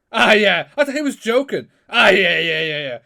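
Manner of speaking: loud, dismissive brush-off; mock dismissive. Hearing his friends met Shibata, loud mocking disbelief. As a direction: wave it away as a joke. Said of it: "I was like".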